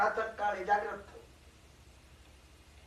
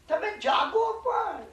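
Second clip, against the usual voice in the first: urgent plea; 10 semitones higher, much slower than usual. Real, not acted. Urging listeners to wake.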